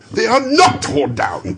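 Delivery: high, loud, slow burst of relief; overjoyed exclamation; joyful cry. Acted. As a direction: cry out in disbelieving joy. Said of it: "cried Scrooge".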